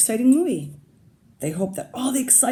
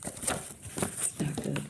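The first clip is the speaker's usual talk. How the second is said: whispered, slow aside; hushed aside; 3 semitones lower, 9 dB quieter, much slower than usual. Real, not acted. Teacher hushing to the class, slow whisper. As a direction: drop to a slow whisper for effect. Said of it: "teacher aside on dog's breath".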